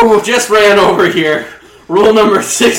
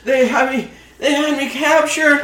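high-pitched victim voice; frightened escapee; 7 semitones higher than usual. Acted. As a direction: plead in a high little voice. Harold escapes being cooked.

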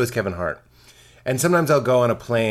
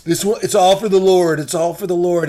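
mock pious pastor; 3 semitones higher, 4 dB louder than usual. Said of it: "the ones that are like".

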